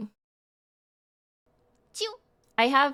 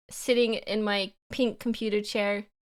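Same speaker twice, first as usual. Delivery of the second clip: ordinary talk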